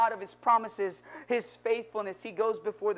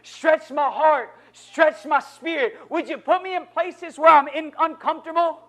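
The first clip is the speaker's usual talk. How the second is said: building prayer, slowing and growing louder on each stretch; impassioned prayer; 3 semitones higher, 6 dB louder than usual. Acted. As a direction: plead it, stretch my heart, stretch my spirit, climbing. Leads an example prayer.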